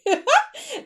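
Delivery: high, loud burst of laughter; excited laugh. Real, not acted. Coach thrilled to reveal her new program, excited laugh. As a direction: let out a real squealing laugh of excitement. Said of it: "I am so excited about it".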